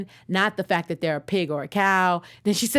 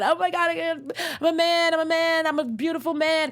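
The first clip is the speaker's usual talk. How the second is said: overjoyed pig turned man; 10 semitones higher than usual. Pretend. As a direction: squeal it out like you can't believe your luck. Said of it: "he's, like, so excited".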